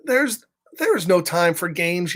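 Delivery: usual speaking voice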